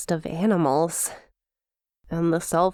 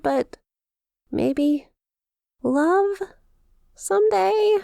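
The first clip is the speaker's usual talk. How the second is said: flustered; 10 semitones higher, slower than usual. Acted. Demon confessing feelings, flustered.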